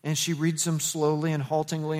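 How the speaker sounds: usual speaking voice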